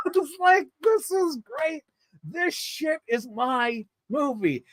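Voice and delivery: high, slow words through real laughter; can barely get it out for laughing. Real, not acted. Telling about loving the movie while laughing hard.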